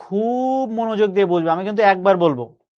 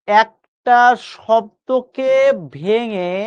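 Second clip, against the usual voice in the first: slow emphatic teaching; much slower than usual. Shown as a demonstration. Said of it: "একবার শুনবা মন দিয়ে".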